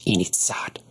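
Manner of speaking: whispered hiss of Initsad; hissed under breath. A narrator's reading. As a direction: spit Initsad out as a whispered insult. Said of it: "she hissed under her breath".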